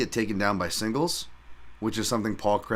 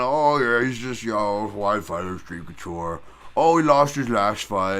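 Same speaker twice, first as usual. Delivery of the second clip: mock dismissive hater; 4 dB louder than usual. Mocking haters of the fighter.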